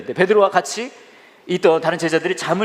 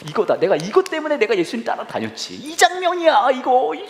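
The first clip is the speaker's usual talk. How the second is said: much higher, louder thrill; excited Peter; 13 semitones higher, 3 dB louder than usual. Retold or imitated. Peter rejoicing on the mountain, much higher and louder. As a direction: seize the moment, overjoyed.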